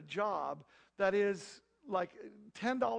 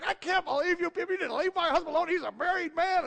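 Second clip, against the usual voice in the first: high, loud furious tirade; angry wife ranting; 10 semitones higher, 10 dB louder than usual. Retold or imitated. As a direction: rip into the caller as the wife. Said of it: "she rips into Shalimar".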